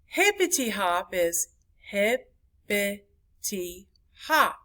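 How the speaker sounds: hip-pi-ty hop, a pause between each beat; syllable by syllable. Shown as a demonstration. Teacher showing four syllables, hippity hop broken up. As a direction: break hippity hop into four separate beats. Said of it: "That's four syllables".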